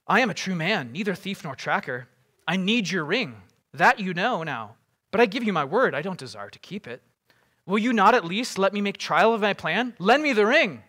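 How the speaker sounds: earnest urging, building to a loud demand; Boromir's insistent plea. Acted. Warrior asking Frodo for the ring, insistent. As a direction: press harder until it becomes a demand.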